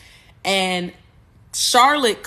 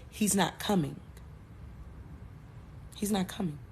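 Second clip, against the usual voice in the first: soft, subdued retold line; hushed disbelief; 13 dB quieter than usual. Bride drops the phone, he's not coming, hushed disbelief. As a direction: say it quietly, as if it is sinking in. Retold stunned news of jilting.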